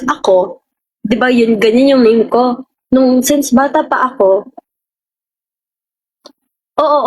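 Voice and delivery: usual speaking voice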